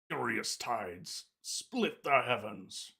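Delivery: very slow, stretched theatrical incantation; portentous callout, each phrase drawn out; dramatic combat callout. Retold or imitated. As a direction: imitate a game character's dramatic battle line. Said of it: "like, a dramatic voice call out".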